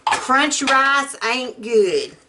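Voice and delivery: ordinary talk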